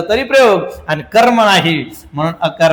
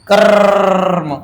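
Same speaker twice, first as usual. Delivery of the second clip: very drawn-out term; 3 dB louder, much slower than usual. Shown as a demonstration. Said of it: "teacher stretches term".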